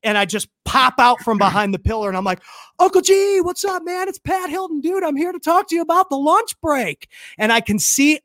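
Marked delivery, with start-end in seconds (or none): 2.8-7.1 high-pitched, eager hail; excited greeting. Retold or imitated. Fan ambushing Cardone from behind pillar, high excited greeting. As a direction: pop out with a breathless pitch.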